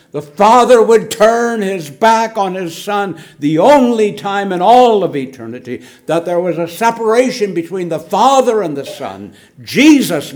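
loud, high fervent preaching; fervent pulpit climax; preaching at full force. Real, not acted. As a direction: proclaim it with rising fervour. Preaching climax about Calvary.

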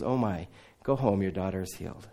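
a hushed, low kind word; soft, low blessing. Retold or imitated. Jesus telling the widow her daughter is healed, soft and low. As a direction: say it quietly and low, like a gift.

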